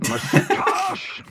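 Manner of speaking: high loud startled cry; shocked outburst. Acted. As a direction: Badger shocked at the news, crying out.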